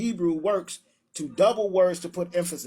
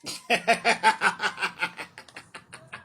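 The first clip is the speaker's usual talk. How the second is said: a long, mocking chuckle; amused laugh. Real, not acted. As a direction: laugh it off, scornful. Laughing at opponent's view.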